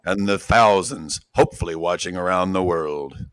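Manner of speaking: grand entrance announcement. Acted. Ring announcer build-up, quiet.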